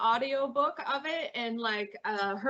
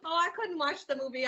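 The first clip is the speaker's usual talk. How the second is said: normal talking voice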